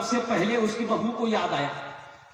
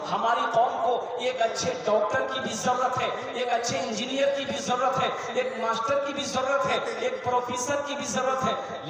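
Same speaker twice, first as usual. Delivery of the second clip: higher-pitched, insistent list cadence; emphatic preaching; 6 semitones higher, 3 dB louder than usual. Real, not acted. Preacher tells community what professionals it needs, emphatic preaching. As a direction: press each need home in a raised sermon voice.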